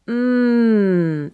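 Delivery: relaxed open hum. Shown as a demonstration. Coach showing the open hum to students, relaxed. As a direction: hum with space between the teeth. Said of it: "It's not closed".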